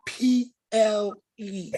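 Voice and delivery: slow, drawn-out disgusted lettering; each letter dragged out in disgust. Real, not acted. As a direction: spell it out slowly with distaste. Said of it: "The way he said it, you sounded disgusted".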